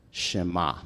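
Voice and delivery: Shema said as SHEH-mah, stress on the first syllable; Hebrew word. Shown as a demonstration. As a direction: say Shema slowly, falling at the end. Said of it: "It's spelled S H E M A".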